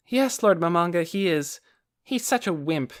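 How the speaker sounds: put-on high, cheeky girl voice; young girl voice. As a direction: grumble about your brother. Aura complaining about her brother.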